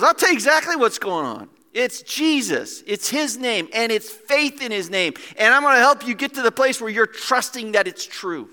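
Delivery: preacher fired up in Peter's role; excited proclamation. Acted. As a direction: declare it with fervent conviction. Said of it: "I got a little excited".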